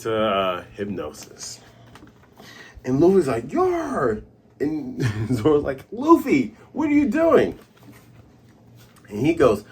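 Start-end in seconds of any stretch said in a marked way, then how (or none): 3.5-4.2 high drawn-out "yar"; mock roar, pitched way up; imitated war cry. 5.9-7.6 high-pitched exclaimed question; alarmed call. Retold or imitated. Acting out Zoro shouting at Luffy.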